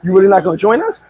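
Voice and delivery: ordinary delivery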